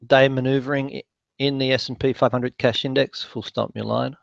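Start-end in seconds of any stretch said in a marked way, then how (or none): 3.2-4.1 quieter dictation command aside; dictating. Real, not acted. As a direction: drop the voice to dictate punctuation. Dictating punctuation to software.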